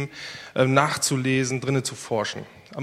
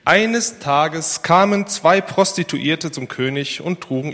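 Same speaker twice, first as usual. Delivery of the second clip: lively, louder Bible story reading; 5 dB louder than usual. Acted. Pastor reading the Solomon story to the congregation, lively. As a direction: read it like a vivid tale to the church.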